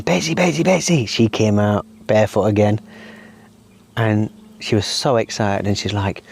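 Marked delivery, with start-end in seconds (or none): 0.0-1.1 high-pitched but restrained calling out; retold screaming. Dad calling daughter to see a frog, mild retold scream. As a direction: mimic an urgent call without full volume. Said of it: "So I'm screaming".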